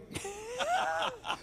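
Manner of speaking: high squealing laugh; burst of laughter. Real, not acted. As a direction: laugh out at the joke. Joke about new term.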